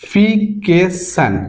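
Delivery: very slow, each syllable held apart. Shown as a demonstration. Teacher showing students the long word, syllable by syllable. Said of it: "সিলেবালি যদি উচ্চারণ করতে".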